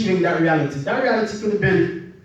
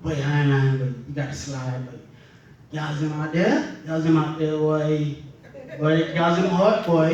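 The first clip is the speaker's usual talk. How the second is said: put-on Bahamian accent; 4 semitones lower than usual. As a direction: lower Bahamian accent, bragging to a young boy. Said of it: "with an accent".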